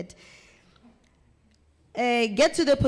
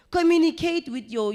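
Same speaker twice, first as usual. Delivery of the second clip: higher and louder, punching Communicate; loud emphatic call; 8 semitones higher, 6 dB louder than usual. Shown as a demonstration. Presenter urging trainees, higher and louder on Communicate. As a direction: hammer the key word home. Coach stressing key advice.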